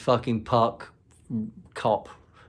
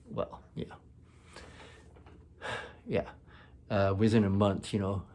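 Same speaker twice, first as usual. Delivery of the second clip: ordinary delivery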